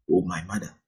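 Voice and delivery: ordinary talk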